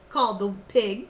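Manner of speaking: usual speaking voice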